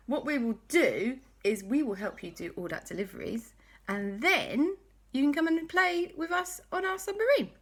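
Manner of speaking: high, lively offer to help; cheerful friends. Acted. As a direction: chirp the plan brightly. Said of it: "And Quasi Cat and Dashy said".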